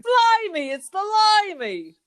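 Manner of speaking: high, loud cockney exclamation; cockney blimey. Pretend. Co-host punning in loud cockney. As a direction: shout it like a stage cockney.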